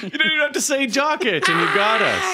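shouting through laughter, pitch way up; excited shout. Real, not acted. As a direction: yell it delighted, mid-laugh. Laughing panel reaction to joke.

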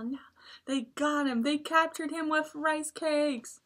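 ordinary delivery

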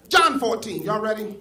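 loud, high rallying call to the church; preacher's call. Real, not acted. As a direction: shout it out to wake the congregation up. Preacher calls out to the congregation.

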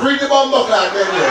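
loud, high, curt refusal with attitude; rude Jamaican store lady. Retold or imitated. Jamaican shop lady loudly snapping at a customer, no. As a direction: shut the customer down with a loud snap. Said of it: "they face me already like this".

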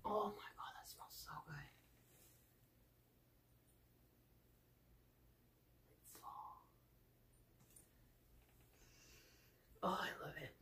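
hushed unvoiced whisper. Real, not acted. Whispering while smelling the candle.

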